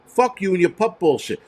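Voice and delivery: ordinary talk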